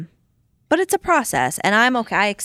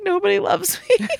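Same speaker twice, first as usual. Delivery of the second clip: high whiny pity-me voice; mock self-pity; 14 semitones higher than usual. Mom mocking her own self-pity to her friend, high whine. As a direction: play the unloved martyr, tongue in cheek. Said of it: "And I'm like".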